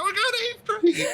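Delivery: high, laughing, near tears with joy; ecstatic laughing cry. Retold or imitated. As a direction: shriek it out like you can't believe it. Friend rings up, ecstatic.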